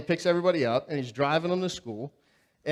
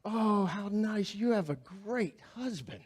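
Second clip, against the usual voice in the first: admiring teachers; 5 semitones higher, 5 dB quieter than usual. Retold or imitated.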